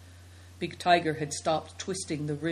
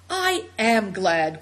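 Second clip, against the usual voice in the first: slow, louder glad cry; a girl crying out for joy; 2 semitones higher, 5 dB louder, slower than usual. A narrator's reading. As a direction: let the joy burst out slowly. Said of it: "cried Seven Stars".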